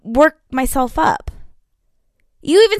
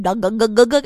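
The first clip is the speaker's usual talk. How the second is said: rapid throaty gulping noises; mock glugging sound; imitated chugging; 3 semitones lower than usual. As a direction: mimic someone downing a drink in big gulps. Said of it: "wants to just chug that shit".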